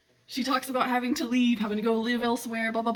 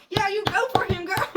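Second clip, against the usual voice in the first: excited cheer; 9 semitones higher, 4 dB louder than usual. Retold or imitated. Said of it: "And I'm like".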